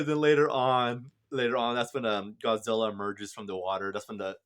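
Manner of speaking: usual speaking voice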